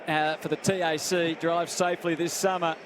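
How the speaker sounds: ordinary talk, nothing marked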